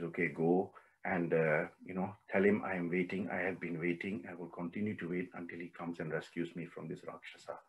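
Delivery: low, very soft captive's message; quiet, patient longing, fading away; hushed plea. Retold or imitated. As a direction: let the plea sink almost to nothing. Voices captive Sita's message to Rama.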